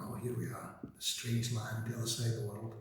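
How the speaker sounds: hushed crowd reaction. Retold or imitated. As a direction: voice a crowd taking in a strange land, quietly. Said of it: "kind of going".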